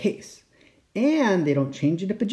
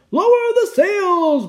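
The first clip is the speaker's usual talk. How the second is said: high, loud, drawn-out order; captain's shout; 6 dB louder, slower than usual. A narrator's reading. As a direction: shout orders across a stormy deck. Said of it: "Braidbeard shouted".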